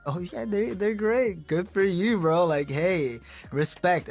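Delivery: high-pitched, offhand mock praise; polite hype. Musician humouring a friend's band, high polite hype.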